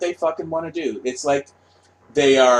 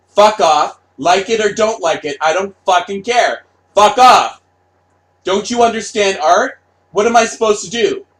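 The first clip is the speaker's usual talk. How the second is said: higher, louder ranting; angry rant; 4 semitones higher, 6 dB louder than usual. Retold or imitated. Artist yelling at fans who don't understand art, angry rant. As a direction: hurl it at the fans.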